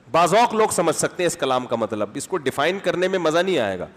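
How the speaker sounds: ordinary talk, nothing marked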